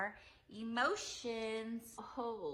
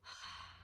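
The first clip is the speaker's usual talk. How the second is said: release sigh; 12 dB quieter than usual. Shown as a demonstration. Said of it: "And then I release".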